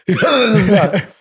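laughing. Real, not acted. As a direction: laugh while repeating it.

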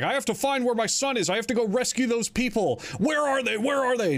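high, urgent pleading; desperate, voice climbing up; frantic detective. Retold or imitated. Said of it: "he's like".

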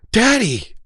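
high, drawn-out call; pleading daughter. Retold or imitated. Daughter calling back to dad, pleading. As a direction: call back to him in the rain. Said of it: "she goes".

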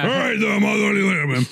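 rushed, high-pitched mock texting voice; fast hammed-up sign-off in a put-on voice. Co-host signs off his parody text to a friend, mock texting voice. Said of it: "what I sound like in text form".

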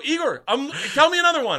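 high, loud, eager shout; excited demand. Retold or imitated. Eagerly asking Igor in retold scene.

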